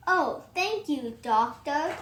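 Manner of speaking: usual speaking voice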